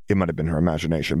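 ordinary delivery

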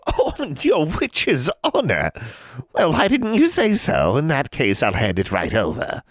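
high, slow, sarcastic hag voice; mocking. A narrator's reading. Hag scoffing at a girl's witch's honor, mocking. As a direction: ham up the mockery.

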